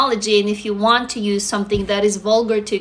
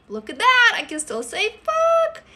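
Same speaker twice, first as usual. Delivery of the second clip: much higher, giddy at getting away with it; gleeful surprise; 10 semitones higher than usual. Real, not acted. Streamer tells viewers she still can swear, high giddy glee. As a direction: squeal it, delighted and mischievous.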